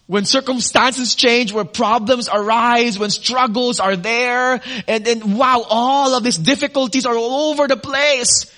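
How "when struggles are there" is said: usual speaking voice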